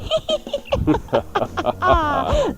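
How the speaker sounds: high-pitched Halloween cackle; put-on spooky laugh. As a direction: cackle high like a playful witch. Spooky Halloween show opening.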